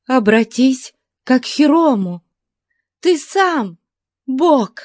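high, fervent woman's voice; exclaiming. A narrator's reading. Captive flatters her captor as a god, high exclamation. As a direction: proclaim it high and urgent. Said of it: "воскликнула она".

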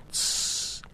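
long hissed "shh"; whispered shush. A narrator's reading. Guest hears steps in the corridor, whispered shush. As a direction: hush sharply with breath only. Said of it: "шепнул гость".

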